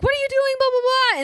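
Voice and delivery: very high-pitched imitation of a frantic question; mock outcry. Retelling Zoe jumping on him, high mock outcry. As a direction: squeal it out as a retold protest.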